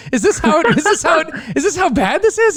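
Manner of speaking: high, stunned disbelief; incredulous boss. Retold or imitated. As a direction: ask it like you can't believe it. New boss shocked.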